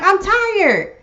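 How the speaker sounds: high, exasperated inner rant; frazzled wife venting what she really thinks; inner exasperation. Retold or imitated. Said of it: "But inside, you're like".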